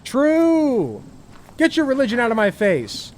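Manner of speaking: shouted agreement. Real, not acted. Streamer cheering on clip character.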